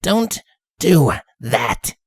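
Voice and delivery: slow, cold threat; cold warning. Acted. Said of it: "the tone of Rainbow's voice".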